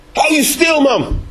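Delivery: sharp high rebuke, hush him; scolding crowd. Acted. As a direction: tell the beggar off from above. Voicing the crowd rebuking Bartimaeus. (bestraffend roepen)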